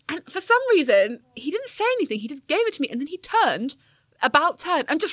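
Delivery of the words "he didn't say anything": normal talking voice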